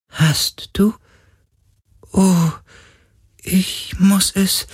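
high, slow spirit voice; a ghost touched and surprised; wondering ghost. Acted. Ghost answers Galen's promise, high and slow.

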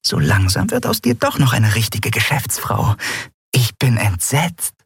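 high, teasing aside; Nelen. A narrator's reading. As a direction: tease a friend with mock dismay. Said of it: "flüsterte Nelen ihrer Freundin zu".

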